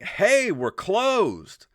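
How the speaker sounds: high-pitched call across the room; re-enacted warning holler; retold yell. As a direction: call out to someone far off. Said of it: "And I yelled up to them".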